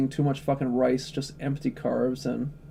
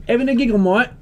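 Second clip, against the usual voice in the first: high, loud mock Brit-Aussie accent; hammed-up heckling in a British accent; put-on British-Australian accent; 10 semitones higher, 5 dB louder than usual. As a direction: needle them in an over-the-top British-Australian accent. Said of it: "maybe a hybrid".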